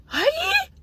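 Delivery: very high, loud shocked "Hayi!"; outraged cry. Real, not acted. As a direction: shriek out in disbelief. Outraged exclamation.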